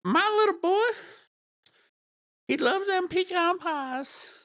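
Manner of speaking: high, loud Southern mom voice; a proud Georgia mama bragging; Georgia accent. Shown as a demonstration. Showing off his Georgia accent as a proud mom. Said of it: "do a good Georgia accent".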